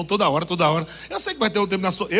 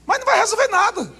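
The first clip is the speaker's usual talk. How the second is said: sharp high punchline; high emphatic exclamation; 11 semitones higher than usual. Real, not acted. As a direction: leap up high and declare it. Preacher sudden high line.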